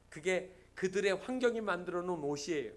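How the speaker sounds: ordinary talk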